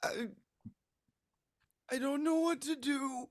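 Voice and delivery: high small frightened voice; a lost little kid near tears; scared child. Acted. Rabbit child clinging to branch, high and frightened. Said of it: "He's like".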